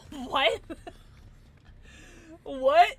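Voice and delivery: ordinary talk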